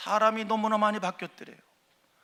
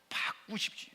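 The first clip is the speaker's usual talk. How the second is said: whispered plea; 8 dB quieter than usual. Acted. Preacher drops to emphasis.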